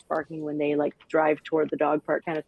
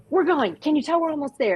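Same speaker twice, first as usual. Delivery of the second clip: much higher, squealing with excitement; excited owner; 11 semitones higher than usual. Retold or imitated. Owner in the car winding up her dog, high excited voice. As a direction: tease the dog that you're almost there. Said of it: "you're like".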